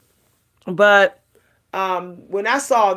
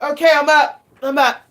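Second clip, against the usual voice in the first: sudden get-up energy; 5 semitones higher, 5 dB louder than usual. Retold or imitated. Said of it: "and I'll be like".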